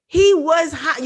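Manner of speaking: real anger rising in the telling; heated. Real, not acted. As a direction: push it out hot and mad. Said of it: "I'm getting mad just thinking about it".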